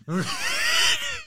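very high, cackling laugh; shrieking laugh. Real, not acted. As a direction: howl with laughter. Reacts to flipped camera.

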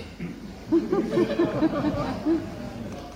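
high laugh bursting out; real laugh. Real, not acted. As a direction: laugh at your own setup. Laughs at the volunteer.

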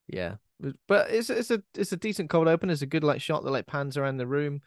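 ordinary talk, nothing marked

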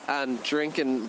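ordinary talk, nothing marked

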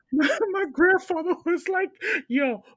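high, loud laughter through the words; can barely get it out for laughing. Real, not acted. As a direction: crack up while telling it. Laughing retelling grandfather.

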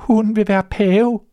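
high anxious voice; trembling with fear. Acted. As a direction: tell it fearfully, afraid of the answer. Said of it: "rystende af angst". (bange og rystende)

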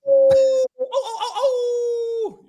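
high laughing wolf howls. Shown as a demonstration. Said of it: "Give me a wolf howl".

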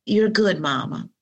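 normal talking voice

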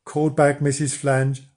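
usual speaking voice

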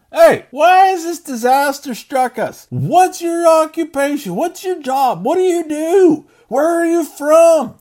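high, loud, slow frightened questioning; panicked sailors. Acted. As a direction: fire questions at him in a storm panic. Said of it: "They ask him".

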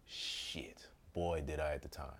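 under-the-breath curse; whispered curse. Real, not acted. Regretful aside.

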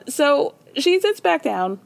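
ordinary delivery

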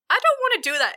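high-pitched reluctant refusal; put-on high voice, balking; Osiris. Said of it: "And Osiris is like".

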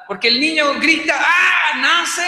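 loud high shout as the newborn; baby's birth scream. Pretend. Preacher voices the newborn crying out, loud and high. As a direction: cry out like a baby just born.

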